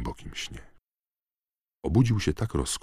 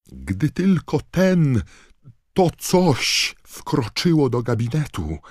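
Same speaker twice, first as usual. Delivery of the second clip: high, loud, slow shriek; screamed in fury; 12 semitones higher, 10 dB louder, slower than usual. A narrator's reading. McGonagall screaming over Knot, trembling with rage. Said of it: "wrzasnęła dygocąc cała ze złości".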